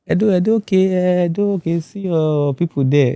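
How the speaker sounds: high-pitched retold call, easing down; imitated welcomers. Camp people send him to his state people, high imitated call. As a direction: start high and settle as you direct him.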